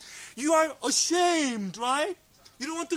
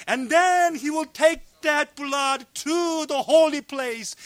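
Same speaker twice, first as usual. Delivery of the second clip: loud, raised preacher's voice; impassioned preaching; 3 semitones higher, 6 dB louder than usual. Real, not acted. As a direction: preach it out with full force. Preaches at full force.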